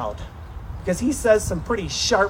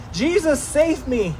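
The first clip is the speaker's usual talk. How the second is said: crying out for help; 7 semitones higher than usual. Retold or imitated. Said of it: "had to cry out in humility and helplessness".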